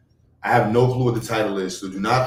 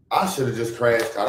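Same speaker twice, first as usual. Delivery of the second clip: ordinary talk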